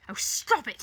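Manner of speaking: loud, angry growl; forceful growled order. A narrator's reading. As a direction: growl it out as a command. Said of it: "he growls".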